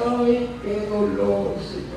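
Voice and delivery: soft, slow pained sigh; a tired lament to God at night; weary moan of pain. Retold or imitated. Retold sufferer moans at home.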